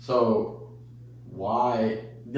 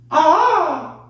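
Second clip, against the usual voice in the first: a high, loud squawk like a mating bird; mating bird call; 10 dB louder than usual. Pretend. Woman showing off her mating bird imitation, high loud squawk. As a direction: screech it out like a bird calling for a mate. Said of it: "I could do a great hummingbird".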